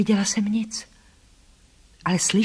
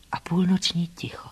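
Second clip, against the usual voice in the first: usual speaking voice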